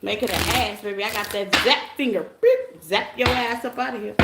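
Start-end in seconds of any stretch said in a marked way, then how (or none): 2.4-2.8 high, loud mock zap beep. Reader zaps trolls out of the chat, high beep. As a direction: make the sound of a zapper.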